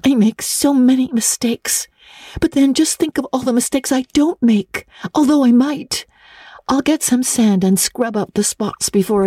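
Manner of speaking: put-on high girl's voice, rushing and heartfelt; a young girl pouring out her longing; eager girl Anne pleading. A narrator's reading.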